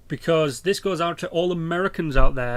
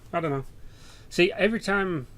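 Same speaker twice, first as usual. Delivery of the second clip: ordinary talk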